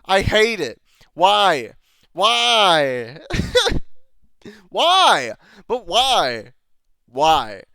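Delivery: half-laughing anguished whys; exasperated. Real, not acted. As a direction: wail why at the show in mock despair. Exasperated ranting at the show.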